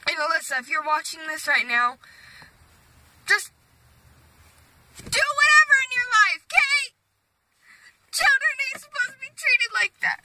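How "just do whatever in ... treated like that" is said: high, tearful urging; upset plea. Real, not acted. Girl urging her stepsister Alyssa to live freely, high and tearful. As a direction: plead on the edge of tears.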